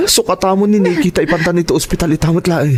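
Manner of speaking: high frantic urging, tears breaking on the last word; panicked urging. Acted. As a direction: push them to hurry, scared for the child. Father urges rush to hospital. (nadanagan a panangpadaras, agsangsangit)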